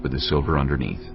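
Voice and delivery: ordinary delivery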